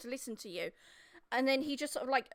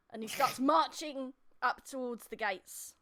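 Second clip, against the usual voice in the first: ordinary talk, nothing marked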